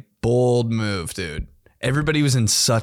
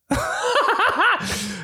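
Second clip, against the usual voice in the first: laugh. Real, not acted. Laughing at start.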